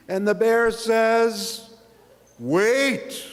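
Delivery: slow loud build to Wait; dramatic twist. A narrator's reading. Bear interrupting the refrain, slow emphatic Wait. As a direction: hold it, then Wait. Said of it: "The bear mutters".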